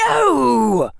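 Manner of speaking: high, loud cry of denial; indignant high-pitched yell; shouted. A narrator's reading. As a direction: shout it in outrage. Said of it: "Harry shouted".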